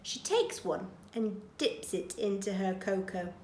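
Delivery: ordinary delivery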